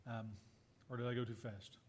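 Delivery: real off-hand murmur, low and quiet; muttered aside. Real, not acted. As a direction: mutter to yourself while finding the place. Lost his place, muttering to himself.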